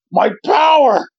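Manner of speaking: high, slow melodramatic fading hero; milk it like an anime death scene; hammed-up anguish. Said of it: "ham it up".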